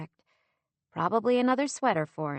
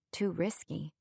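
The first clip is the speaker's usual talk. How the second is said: plain reading voice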